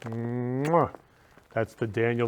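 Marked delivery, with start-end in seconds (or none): 0.7-0.9 blown kiss. Real, not acted. Blowing kisses to guests.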